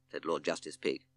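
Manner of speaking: plain reading voice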